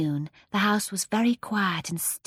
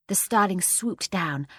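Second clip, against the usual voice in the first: ordinary delivery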